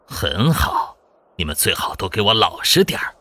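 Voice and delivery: menacing villain voice, slightly held back; cold sneer. A narrator's reading. Hostage-taker warning people to behave, sneering coldly. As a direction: threaten with cold contempt. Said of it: "不禁冷笑道".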